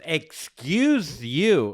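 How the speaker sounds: high, loud and very slow, dragged out; snooty excuse you. Retold or imitated. Boyfriend mocking how Brenna snapped at the old lady, drawn-out snooty tone. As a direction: drag out Excuse you haughtily. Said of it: "Didn't say it like that".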